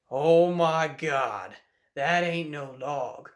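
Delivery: slow, drawn-out amazement; awed, slow disbelief sinking to a hush; dawning realization. Retold or imitated.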